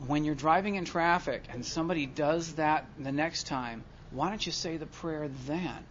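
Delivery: quiet, even sponsor advice; lightly voiced patient sponsor; calm sponsor. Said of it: "And he goes".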